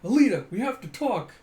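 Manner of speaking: hammed-up we-need-to-talk line; mock serious boyfriend. The man to Alita before proposing, mock solemn.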